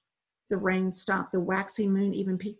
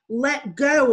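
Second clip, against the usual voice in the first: loud, higher yell down from the boat; 6 semitones higher, 10 dB louder than usual. Acted. Woman on the dinghy yells at swimmers, loud yell. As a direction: bark the order down at them. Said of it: "a woman in the dinghy yelled".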